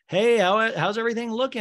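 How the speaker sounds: high, loud mock phone hello; phone greeting. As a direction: imitate someone chirping a hello down the line. Acting out a cheery phone greeting.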